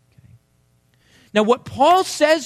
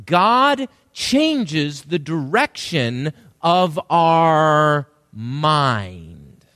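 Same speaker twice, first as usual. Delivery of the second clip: very slow, with "mind" drawn out at the end; weighty, spaced-out line landing on "mind"; stress on mind; 3 semitones lower, much slower than usual. Shown as a demonstration. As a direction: build word by word to a long "mind". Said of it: "That's the word of emphasis".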